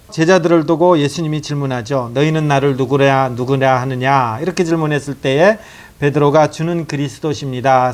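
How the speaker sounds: normal talking voice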